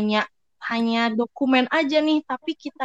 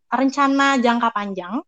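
usual speaking voice

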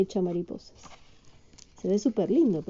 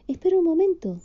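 high, louder Winnie voice; 9 semitones higher, 5 dB louder, faster than usual. Acted.

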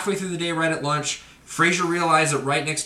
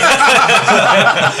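big loud laugh breaking out; howling laugh at the punchline; loud burst of laughter; 8 semitones higher, 10 dB louder than usual. Real, not acted. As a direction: burst out laughing at your own prank. Breaks up after the reveal.

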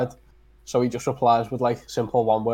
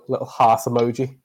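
ordinary talk, nothing marked

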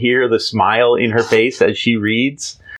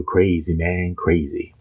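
beatnik voice; 3 semitones lower, 5 dB quieter, slower than usual. Retold or imitated. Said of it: "they would go".

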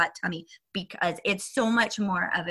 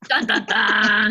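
slow, loud, laughing sting; laughing dun dun dun; 8 dB louder, slower than usual. Pretend. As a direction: ham up the suspense chord, laughing. Echoes the dramatic sting, laughing.

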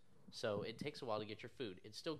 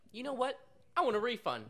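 high, louder imitation of an upset customer; mock indignant demand; 10 semitones higher, 6 dB louder than usual. Fed-up pizza customer demanding money back, imitated indignantly. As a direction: mimic a customer making a huffy demand.

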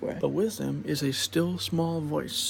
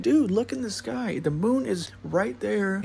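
exasperated pointing; 6 semitones higher than usual. Retold or imitated. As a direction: insist the friend finally look up. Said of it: "I'm like".

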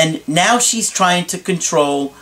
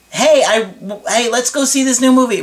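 higher, faster eager voice; eager suggestion; 7 semitones higher than usual. Retold or imitated. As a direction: pitch the movie idea brightly and quickly. Acted partner suggesting a movie.

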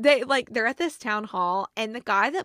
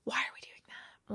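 whispered, disbelieving question; exasperated whisper; 12 dB quieter than usual. Real, not acted. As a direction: hush it like a fed-up aside. Exasperated aside about tropes.

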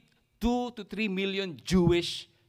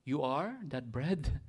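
quieter, slow disappointed question; sad letdown; 3 semitones lower, 6 dB quieter than usual. Retold or imitated. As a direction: let it sag like a letdown. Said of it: "And they said sadly".

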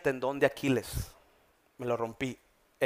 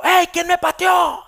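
much higher, loud yell of who kicked me; indignant shout; 11 dB louder, slower than usual. Retold or imitated. Pastor acts yelling on the soccer field, high and loud. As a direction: whirl round and yell at the culprit.

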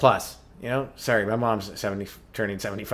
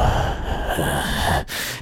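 breathy lustful sigh; 10 semitones lower than usual. Acted. Mock seductive sigh.

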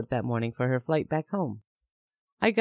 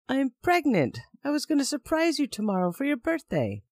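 desperate; 10 semitones higher than usual. Retold or imitated. Desperate pregnancy reveal.

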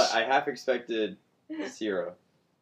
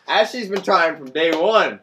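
laughing tease; 7 semitones higher, 12 dB louder than usual. Real, not acted. Laughing tease about Ashley.

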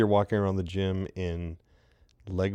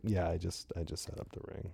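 very soft, low mumbled reply; retold quiet brush-off, fading; sheepish denial; 3 semitones lower, 14 dB quieter than usual. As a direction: shrink it away to almost nothing. Retelling own sheepish denial.

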